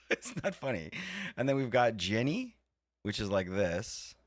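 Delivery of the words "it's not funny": laughing it off, a bit softer; amused giggle through the words. Real, not acted. Streamer laughing at silly voices, laughing softly.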